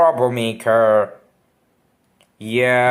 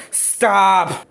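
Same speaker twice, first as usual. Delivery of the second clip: shouting; 7 semitones higher than usual. Acted. Mario yells at Luigi.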